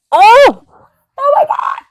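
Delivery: high, loud squeal of horror; retold horrified shriek. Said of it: "And I'm like".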